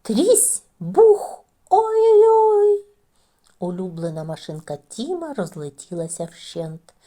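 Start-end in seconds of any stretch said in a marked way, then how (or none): 0.0-2.8 high, loud crash then a slow wail; crash and dismay. A narrator's reading. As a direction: smash it, then moan in dismay. Машинка розлетілася. (гучне «трісь, бух» і протяжне «ой-ой-ой»)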